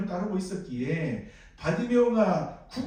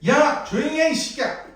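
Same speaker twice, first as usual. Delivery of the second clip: higher, louder mock yell at an adult; rough yell; 7 semitones higher, 5 dB louder than usual. Crowd yelling at grown man, mock angry yell. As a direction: shout him down rudely. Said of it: "라고 소리를 하면".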